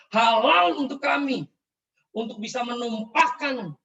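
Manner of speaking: imitating fanatical threat. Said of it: "mereka berkata kepada saya seperti".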